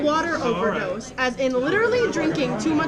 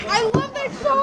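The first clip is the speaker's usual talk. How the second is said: excited; 12 semitones higher, 4 dB louder than usual. Real, not acted. Excited reaction.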